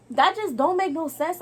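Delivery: loud, high exasperated demand; exasperated outburst. Real, not acted. Ranting to viewers about the garbage bag, exasperated. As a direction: throw it at them in disbelief.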